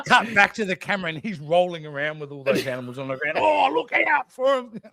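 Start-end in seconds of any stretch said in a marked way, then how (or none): 3.4-4.8 higher, slow Australian jeer; mock Aussie warning. Crew mocking Paris rolling with lizards, Aussie jeer. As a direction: drawl it out teasing him.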